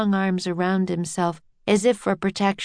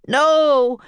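loud raised roar, less high; 7 semitones higher, 6 dB louder than usual. A narrator's reading. Jack backing Annie against the captain, roaring. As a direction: roar it out in a boy's voice. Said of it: "roared Jack".

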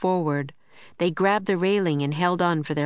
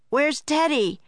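raised, high-pitched call; urgent cry, pitched up; Jack crying out; 9 semitones higher than usual. A narrator's reading. Jack looking for the lost dog, urgent cry. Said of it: "cried Jack".

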